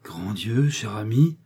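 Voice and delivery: ordinary delivery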